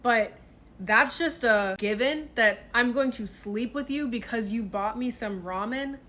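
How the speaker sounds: ordinary talk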